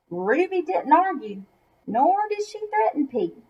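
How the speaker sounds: ordinary delivery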